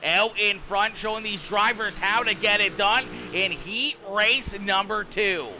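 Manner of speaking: brisk, rising race call building to the flag; race play-by-play. Acted. Streamer calling the heat race finish for viewers, energetic play-by-play. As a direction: call the finish like a live announcer.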